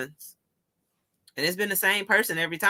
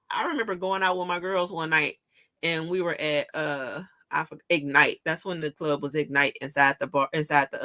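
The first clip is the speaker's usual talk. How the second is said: ordinary talk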